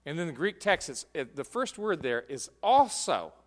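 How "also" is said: "also" punched high and loud; "also" said with strong raised emphasis; emphatic ALSO. Shown as a demonstration. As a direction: hit the word "also" hard. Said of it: "it emphasizes the word".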